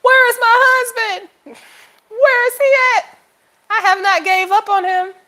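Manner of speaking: high, loud, wailing plea to God; hammed-up cry for a husband; mock crying plea. Begging God for her husband, high and loud, mock wailing. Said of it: "And I'm like".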